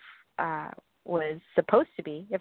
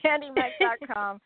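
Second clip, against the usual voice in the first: laughing through candy mac dot com; 6 semitones higher than usual. Real, not acted. Repeats website, laughing.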